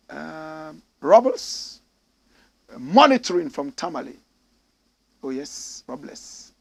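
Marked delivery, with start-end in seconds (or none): none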